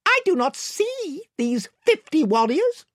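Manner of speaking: high-pitched, measured challenge; Reepicheep. A narrator's reading. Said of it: "observed Reepicheep".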